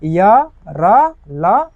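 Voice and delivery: य, र, ल each said slowly and loudly; slow classroom drill of य र ल; demo semivowels. Shown as a demonstration. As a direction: spell out य, र, ल one sound at a time. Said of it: "जैसे य".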